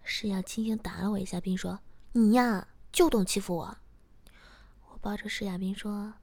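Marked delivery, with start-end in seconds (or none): 2.1-3.8 loud, pouting mock complaint; playful scolding. Girlfriend teasingly scolding boyfriend for bullying, playful complaint. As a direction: punch the first words in mock grievance.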